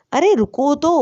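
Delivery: urgent call to wait; calling after him. A narrator's reading. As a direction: call after someone leaving. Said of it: "Lisa آواز دیتی".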